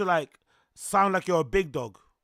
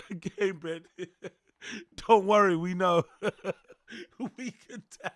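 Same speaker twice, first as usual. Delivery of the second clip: ordinary talk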